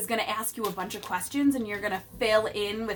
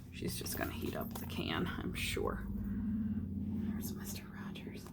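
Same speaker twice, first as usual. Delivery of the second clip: soft secret whisper to the class; whispered aside; 13 dB quieter than usual. Real, not acted. Teacher whispering a guess to the children, hushed. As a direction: lean in and whisper the prediction.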